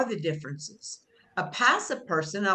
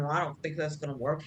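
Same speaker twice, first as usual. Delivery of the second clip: lower, softer grumble under the breath; mock sulky mutter; 4 semitones lower, 5 dB quieter, faster than usual. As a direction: grumble it to yourself. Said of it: "instead of muttering".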